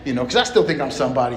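usual speaking voice

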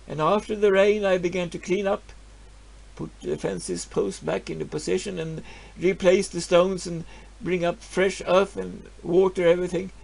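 ordinary talk

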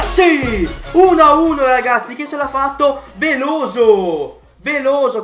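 loud, high goal celebration; breathless italian goal commentary. Real, not acted. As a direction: scream the goal with joy. Goal celebration, shouting scorer.

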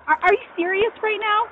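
blurting out amazement at an unexpected gift; real disbelief. Real, not acted. Stunned reaction at doorstep gift.

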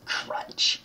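soft whispered crunch noise; a timid little "Crunch"; weak crunch. Shown as a demonstration. Gamer faking the lollipop bite, whispered and weak. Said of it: "That was a very weak crunch".

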